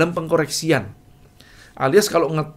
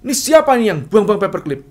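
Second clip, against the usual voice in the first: mock yell; 6 semitones higher, 6 dB louder than usual. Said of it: "teriak-teriak".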